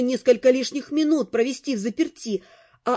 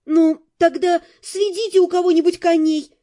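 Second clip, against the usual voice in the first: raised, higher voice, stamping insistence; impatient exclamation; 5 semitones higher, 5 dB louder than usual. A narrator's reading. Heroine urges mercenaries to steal horses, exclaiming. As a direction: burst out with it, loud and high. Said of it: "воскликнула я, притопнув ногой".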